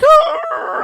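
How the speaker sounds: high mock howl; imitated dog howl. Copying his dog singing her part, high howl. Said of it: "she'd be like".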